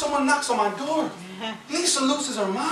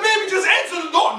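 pleading husband; 9 semitones higher than usual. Retold or imitated. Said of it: "I'd be like".

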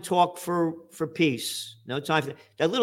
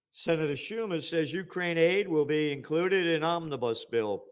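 ordinary talk, nothing marked

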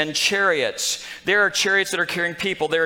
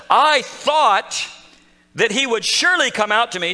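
high, louder indignant complaint; insulted; 5 semitones higher, 4 dB louder than usual. Retold or imitated. Preacher voicing insulted Naaman, indignant and louder. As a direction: sound offended that the prophet stayed inside. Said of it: "He's insulted".

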